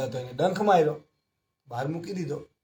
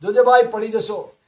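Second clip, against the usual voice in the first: loud, high warning shout; calling out a warning; 6 semitones higher, 9 dB louder, slower than usual. Retold or imitated. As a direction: shout across to someone about to fall. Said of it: "બૂમ પાડીએ ને". (પડતા માણસને મોટેથી ચેતવણીની બૂમ)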